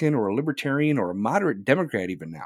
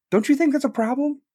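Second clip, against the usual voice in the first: ordinary delivery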